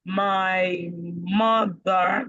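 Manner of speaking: "my mother" dragged out extremely slowly; overdone slow-motion "my mother"; exaggerated slow. Shown as a demonstration. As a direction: stretch every syllable of "my mother" far too long. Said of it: "not that kind of slow".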